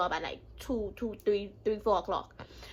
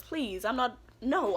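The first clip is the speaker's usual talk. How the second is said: ordinary talk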